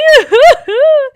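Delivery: high, loud boo-hoo wail; mock bawling. Taya on the shelf, bawling at her father. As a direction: bawl like an upset child. Taya bawls after complaint.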